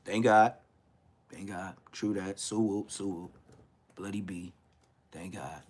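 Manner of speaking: low, very soft murmured refrain; hushed thanks. Real, not acted. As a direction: let it sink down almost to nothing. Quiet thanks for being alive.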